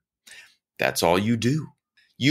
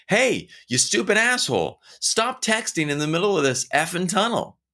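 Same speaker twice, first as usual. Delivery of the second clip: much higher, rude yelled-at-a-stranger jab; hammed-up rude yell, much higher; mock insult; 8 semitones higher than usual. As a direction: bark it like an annoyed passerby. Insulting a driver texting in tunnel.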